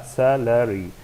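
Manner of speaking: salary stressed on its first syllable. Shown as a demonstration. Teacher showing the stressed syllable, salary stressed on SA. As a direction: lean on SA in salary, then fall. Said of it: "the stress will be sa".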